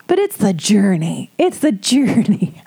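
put-on sing-song voice, words stretched out; slow, hammed-up imitation of a platitude; mocking platitude. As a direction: parrot an empty slogan with mock uplift. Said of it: "when people say bullshit to you".

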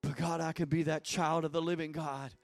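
earnest pleading prayer; fervent prayer. Real, not acted. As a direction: press in with fervor.

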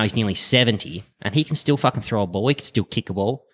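usual speaking voice

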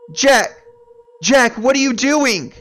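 very high, loud panicked yelling; horrified wife at the crib; alarmed shout. Acted. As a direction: scream his name in alarm.

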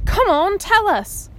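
high, urgent coaxing; eager pleading. A narrator's reading. Gillen begging Omri to tell, eager. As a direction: press him, itching to know. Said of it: "Excitement and envy were in Gillen's voice".

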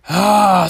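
loud, heavy "ahh" sigh; exasperated sigh. Real, not acted. Ranting about his bad day, exasperated sigh. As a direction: let out a big fed-up sigh.

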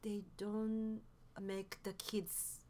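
ordinary delivery

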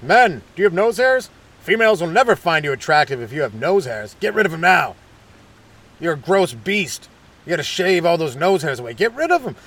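emphatic, punchy sales pitch; hammed-up infomercial urgency; mock advert announcer. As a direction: scold men like a pushy commercial. Mocking nose-hair grooming advertisement.

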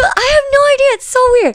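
high, squeaky mock confusion; mock bafflement. Telling a friend about the boyfriend, high mock bafflement. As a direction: throw up your hands in a high voice.

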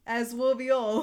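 Aswarby said as "As will be all" in a put-on British accent; hammed-up British. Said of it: "say it in the accent".

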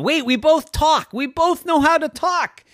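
high, loud eureka burst; excited realization. A narrator's reading. Cave Bear suddenly finding common ground, high excited burst. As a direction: jump up in pitch and exclaim.

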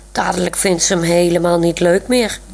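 usual speaking voice

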